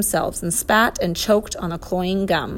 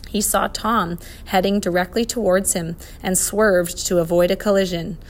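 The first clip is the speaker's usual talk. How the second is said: ordinary delivery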